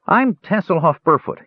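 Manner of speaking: chirpy little kender introducing himself; cheerful kender. Acted.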